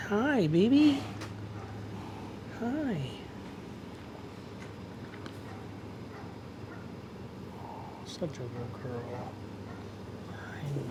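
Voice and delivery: soft, slow sweet talk. Real, not acted. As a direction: coo gently at the horse. Greeting and petting the horse.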